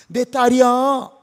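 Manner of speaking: loud, high, drawn-out claim; slow, ringing fatherly claim; protective declaration. Acted. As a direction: proclaim it slowly and forcefully, defending your daughter. God defends his daughter to attackers.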